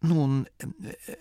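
hesitant, halting start; embarrassed. A narrator's reading. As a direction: stall awkwardly for words. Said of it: "begann der Priester verlegen". (verlegen zögernd)

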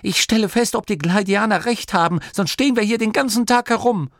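high, loud, fast call back; defiant shout. Acted. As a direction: shout it over her shoulder, fast. Grimma shouts while running off.

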